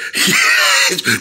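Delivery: a high shouted laugh-cry; anguished laughing cry. Real, not acted. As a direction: wail with laughter in mock pain. Cries out over nickname.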